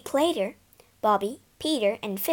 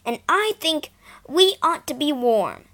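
put-on louder, indignant boy; storming; 6 semitones higher, 6 dB louder than usual. Son storming at his mother about the cold, angry. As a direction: declare it hotly. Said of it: "he stormed".

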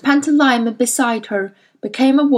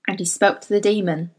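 ordinary delivery

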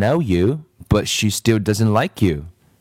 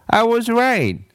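very high smug "See?"; triumphant child; gloating girl; 11 semitones higher than usual. Acted. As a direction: crow over being right. Kathy voiced high.

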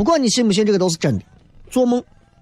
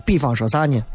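normal talking voice

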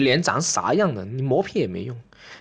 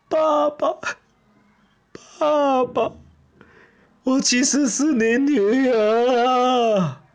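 high, slow, hammed-up child's wail; wailing daughter; 6 semitones higher, much slower than usual. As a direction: play the daughter crying out to dad, stretching it. Imagined ugly daughter reveals herself. (装女儿拖长声哭诉)

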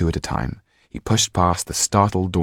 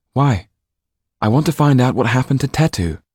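higher, louder voiced exchange; story dialogue; 7 semitones higher, 5 dB louder than usual. A narrator's reading. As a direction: lift into the characters' voices.

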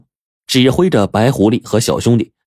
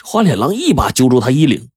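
ordinary delivery

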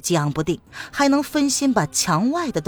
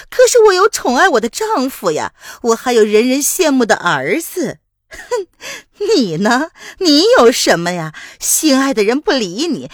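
much higher and louder scornful jeers; spiteful gloating woman; 8 semitones higher, 6 dB louder than usual. Acted. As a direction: twist the knife with every line. 玉兰子 taunting her rival. (高亢刻薄的讥讽)

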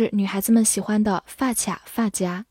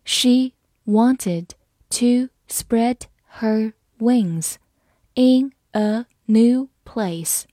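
one word at a time; slow, separated words for learners to follow; slow reading; much slower than usual. Shown as a demonstration. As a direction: read slowly, pausing after each word. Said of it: "我们慢慢来读".

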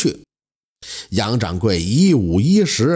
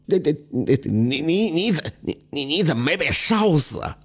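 stammering terror; 2 semitones higher than usual. A narrator's reading. Son stammering at his stepfather back from the dead. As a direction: stammer it out in shock. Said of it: "战战兢兢地说".

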